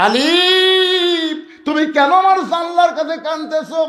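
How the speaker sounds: high, ringing cry; acted anguished call. Abu Zar to Ali crying at his window, anguished call. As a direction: call the name out, then ask high. Friend cries out to weeping Ali.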